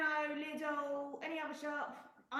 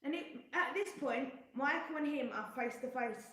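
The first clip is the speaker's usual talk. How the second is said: normal talking voice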